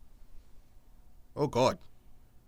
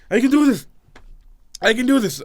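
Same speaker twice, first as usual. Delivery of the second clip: raised pitch, trying to compose; determined recovery from giggles; self pep talk; 6 semitones higher, 5 dB louder, faster than usual. Real, not acted. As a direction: steel yourself after a laughing fit. Psyching himself up after laughing.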